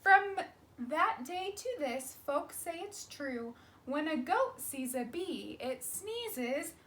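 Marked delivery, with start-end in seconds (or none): none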